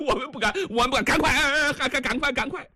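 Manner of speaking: panicked babble. Acted. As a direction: trip over the words in fear. Guards panicking after the threat.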